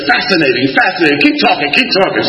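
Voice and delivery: mock eager analyst; fascinated trainee. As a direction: gush like a shrink studying a specimen. Imitating trainee shrink's fascination.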